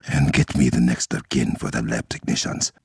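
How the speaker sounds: normal talking voice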